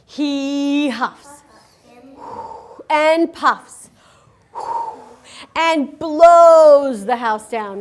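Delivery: growing to a loud slow blow; huffing wolf. Shown as a demonstration. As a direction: build up and blow hard. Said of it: "what does the wolf do".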